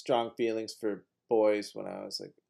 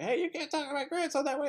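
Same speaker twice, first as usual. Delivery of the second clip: put-on old woman scolding; 5 dB quieter than usual. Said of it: "she'd be like".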